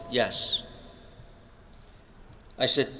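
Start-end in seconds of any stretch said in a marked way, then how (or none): 0.1-0.6 softer, quiet retold answer; soft yes. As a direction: answer gently, barely above a murmur. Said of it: "And he said softly".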